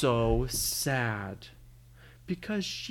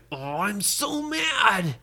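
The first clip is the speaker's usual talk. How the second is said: slow, higher-pitched mock fury; mad; 6 semitones higher, much slower than usual. Caleb suddenly catches Brady's anger, hammed-up mad. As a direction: drag it out in a hammed-up huff. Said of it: "started feeling mad again".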